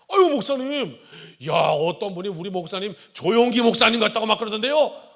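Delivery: buttering up the pastor; eager excited praise; flattering gossip. Retold or imitated. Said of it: "장로님도 얘기하는 거야".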